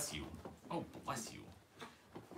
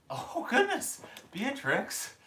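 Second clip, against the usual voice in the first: high startled laugh then calling her name; 12 semitones higher, 6 dB louder, slower than usual. Real, not acted. Woman startled as her puppy bolts, laughing high. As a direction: caught off guard, laughing. Puppy runs screaming around.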